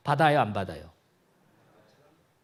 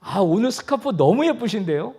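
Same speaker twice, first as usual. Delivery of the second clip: high, loud admiring remark; complimenting; 7 semitones higher, 5 dB louder than usual. Shown as a demonstration. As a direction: flatter a neighbour with open delight. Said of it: "칭찬을 알아요".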